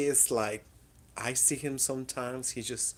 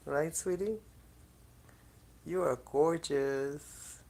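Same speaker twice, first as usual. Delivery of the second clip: high, slow pet-talk; cooing to the cat; 4 semitones higher, slower than usual. Real, not acted. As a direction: dote on the kitty, drawn out.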